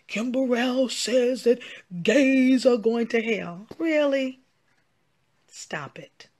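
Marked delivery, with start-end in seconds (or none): 0.1-3.7 mocking rumor quote. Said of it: "what's so demonic about all of this".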